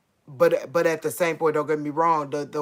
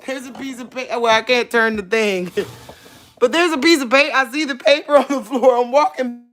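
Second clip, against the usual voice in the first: nervous; 6 semitones higher, 6 dB louder, faster than usual. Real, not acted. Nervously approaching.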